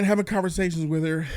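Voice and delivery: ordinary delivery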